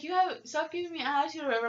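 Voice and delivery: imitating a snippy young coworker; mocking coworker. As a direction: rattle it off like a snotty kid. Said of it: "she's like".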